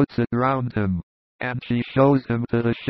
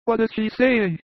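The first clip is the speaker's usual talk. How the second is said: high, curious question; eager shepherd; 11 semitones higher than usual. A narrator's reading. Narrator voices eager shepherd.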